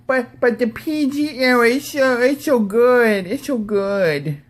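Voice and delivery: high-pitched, drawn-out whiny mockery; mock whining fan. Host mocking PG-era fans watching his video, high mocking whine. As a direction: whine it high, dragging out the words. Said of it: "for all you fuckers who are gonna view this video and go".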